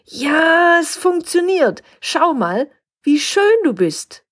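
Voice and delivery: high, delighted voice; overjoyed at its own shine; excited. A narrator's reading. Chip bag thrilled as it swells, high and excited.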